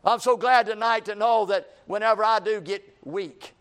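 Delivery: fervent sermon delivery; preaching. Acted. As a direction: preach it to the congregation.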